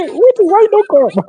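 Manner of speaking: high, startled voice of an uncle; surprised uncle. Retold or imitated. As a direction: act amazed that he turned up at dawn. Uncle questioning him.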